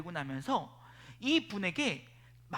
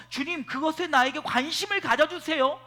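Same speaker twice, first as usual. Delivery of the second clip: louder, higher pleading demands to the Lord; mock demanding prayer; 6 semitones higher, 7 dB louder than usual. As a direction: voice a selfish, insistent prayer. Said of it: "내가 원하는 건".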